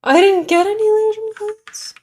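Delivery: squeaky dismayed complaint; high surprised whine. Real, not acted. Dismay at missing game coins.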